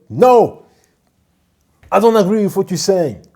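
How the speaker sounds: high, loud upset protest. Retold or imitated. Mimicking the upset nurse as she shouts back at the doctor. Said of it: "she became very emotional, raising her voice".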